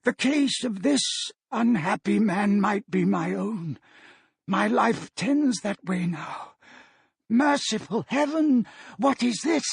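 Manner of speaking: put-on an old miser seeing his own fate, rising dread; shuddering Scrooge. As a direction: Scrooge to the spirit, horrified and pleading. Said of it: "shuddering from head to foot".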